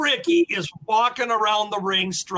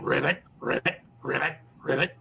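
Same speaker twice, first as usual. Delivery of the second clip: lower, croaking ribbit; frog croak imitation; 7 semitones lower, 3 dB quieter than usual.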